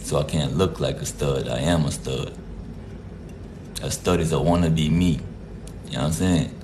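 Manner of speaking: normal talking voice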